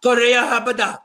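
mock gibberish. Joining the gibberish mockery.

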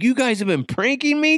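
high, rising disbelief; high-pitched incredulous boy; imitating astonished son. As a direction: ask it like a kid stunned by the prank. Son's astonished question retold.

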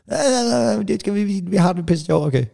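high mocking kid voice. Mother mimics her kids' careless reply, high and mocking. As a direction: ape the kids' careless yes-yes.

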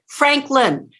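"Franklin" stretched into two distinct beats; both syllables punched. Shown as a demonstration. Coach correcting student, both syllables of "Franklin" punched. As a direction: punch "Frank" and "lin" separately. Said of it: "Two syllables".

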